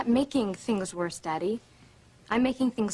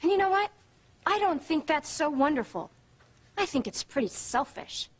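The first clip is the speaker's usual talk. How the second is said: raised, heated retort; indignant; 5 semitones higher than usual. Acted. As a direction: make the accusation sharply, voice pitched up. Daughter attacking her mother's behaviour.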